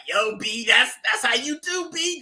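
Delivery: high laughing New York accent impression. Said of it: "in his own New York accent".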